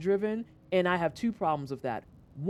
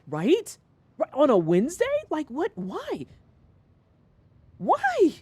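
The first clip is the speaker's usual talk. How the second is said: rising disbelief, final why leaps high and loud; incredulous outburst; 10 semitones higher, 5 dB louder, slower than usual. Retold or imitated. Cashier agreeing with the customer, building incredulous shout. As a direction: build from mock disbelief to a squeaky yelp. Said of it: "And I was like".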